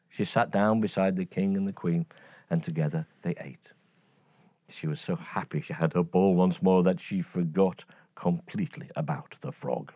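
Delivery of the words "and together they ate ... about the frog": usual speaking voice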